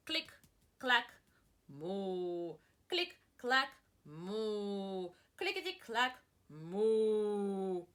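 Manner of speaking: typing and mooing sounds. Acted. Teacher reading the noise refrain, playful sound effects. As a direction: make the typewriter clack and cow moo.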